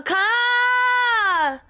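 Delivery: long high-pitched cry out; real alarmed yell; shocked shout. Real, not acted. Reacting to attack on screen.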